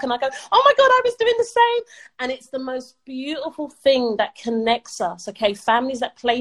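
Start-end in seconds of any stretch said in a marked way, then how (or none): 0.5-1.8 much higher, louder delighted exclamation; excited. Retold or imitated. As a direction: mum squealing back with joy. Mum retelling her excited reply.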